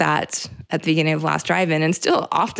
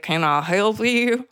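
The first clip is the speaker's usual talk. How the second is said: put-on slow Southern accent on "Can I help you?", a bit higher; 3 semitones higher, slower than usual. Retelling her one film line as it slipped into a Southern accent. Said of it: "the Southern accent would kind of be like".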